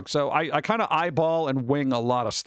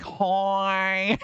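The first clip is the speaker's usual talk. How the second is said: nasal voice; 3 semitones higher than usual. Retold or imitated. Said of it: "that was all nostril too".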